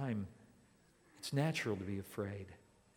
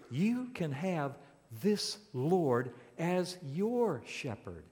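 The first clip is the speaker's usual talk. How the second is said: ordinary talk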